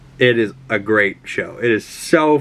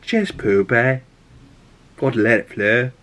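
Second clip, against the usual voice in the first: imitated Liverpool accent at an easy pitch; mock Liverpool accent for a quoted catchphrase; Jamie Tart. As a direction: put on a little Scouse accent. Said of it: "in his little Liverpool accent".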